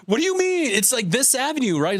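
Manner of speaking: squeaky high voice of disbelief, pleading; high incredulous whine. Retold or imitated. To the store clerk, high-pitched baffled pleading. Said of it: "but I was like".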